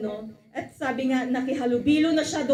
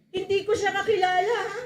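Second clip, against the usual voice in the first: higher, pleading brush-off; nervous denial; 6 semitones higher, 3 dB louder, faster than usual. Acted. Peter insisting to the accuser he doesn't know Jesus, high nervous voice. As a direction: insist you don't know him.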